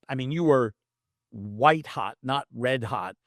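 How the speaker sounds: ordinary delivery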